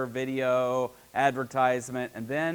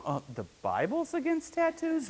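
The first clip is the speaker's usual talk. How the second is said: much higher, softer astonished ask; surprised question; 11 semitones higher, 5 dB quieter than usual. Retold or imitated. New believer hearing the tattoo rule, surprised. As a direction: sound genuinely caught off guard.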